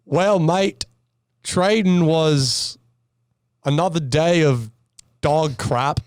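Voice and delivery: put-on Australian accent. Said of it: "if I'm doing an accent right".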